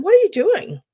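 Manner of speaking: sharp retort. Retold or imitated. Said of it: "So I said".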